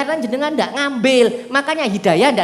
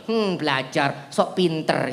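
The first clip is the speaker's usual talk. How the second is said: sneering mock; 8 semitones lower, 8 dB quieter, slower than usual. Mocking friend quoted.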